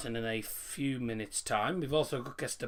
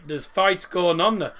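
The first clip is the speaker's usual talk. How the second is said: amused commentary; 5 semitones higher, 9 dB louder than usual. Real, not acted. Reacting to a brawl on the pitch.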